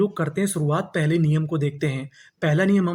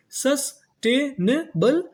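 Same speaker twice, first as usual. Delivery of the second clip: Sustainable stretched very slowly and higher, rising; slow syllable by syllable; 6 semitones higher, much slower than usual. Shown as a demonstration. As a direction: break it into syllables for the class. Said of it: "तो पूरी meaning क्या बनी". (धीरे-धीरे, ऊँची आवाज़ में)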